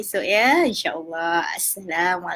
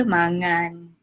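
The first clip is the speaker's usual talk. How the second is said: ordinary delivery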